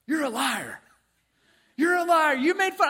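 high, very loud accusing yell; angry child shouting. Retold or imitated. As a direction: imitate an outraged boy screaming at Dad. Retold upset son accusing dad.

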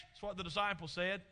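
ordinary delivery

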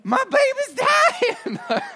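high, drawn-out mock wail; hammed-up mother in despair; panicked mom. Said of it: "She doesn't know what to do".

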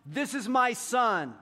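high, loud, slow proclamation; voice of God. Acted. God to the disciples about his son, proclaiming loudly. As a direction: proclaim it slowly as God from heaven. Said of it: "here's the voice of God".

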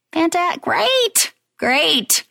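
high, drawn-out dismissive "great"; mock congratulations. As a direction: give a big, sarcastic cheer for them.

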